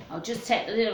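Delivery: usual speaking voice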